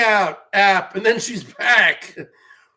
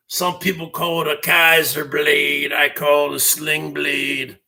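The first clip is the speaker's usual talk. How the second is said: Billy Bob Thornton impression; 6 semitones lower than usual. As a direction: sink way down into a mumbling backwoods Sling Blade voice. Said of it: "like Billy Bob Thornton from Sling Blade".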